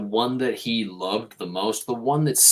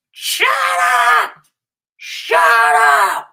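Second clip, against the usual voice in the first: much higher, much louder screamed shut up; furious yell; 11 dB louder, much slower than usual. Retold or imitated. Dog owner next door screaming at dogs, full-volume yell.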